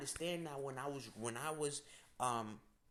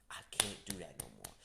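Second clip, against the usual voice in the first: hushed, breathy confession to herself; a dropped, private whisper; 12 semitones lower, 6 dB quieter than usual. Real, not acted. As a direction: whisper it like admitting a secret. Private realization, confessing aside.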